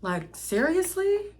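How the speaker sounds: high, drawn-out disbelief; incredulous. Real, not acted. Storyteller reacting to the bathroom rumor, incredulous. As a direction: stretch it upward in disbelief.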